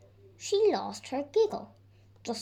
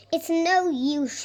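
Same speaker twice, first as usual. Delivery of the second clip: sighing; 6 semitones higher, 7 dB louder, slower than usual. A narrator's reading. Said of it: "she sighed".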